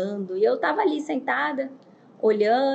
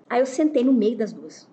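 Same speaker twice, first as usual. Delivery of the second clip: normal talking voice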